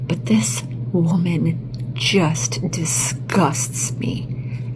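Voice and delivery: disgusted whisper. Real, not acted. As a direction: breathe it out in disgust. Disgusted reaction to a video.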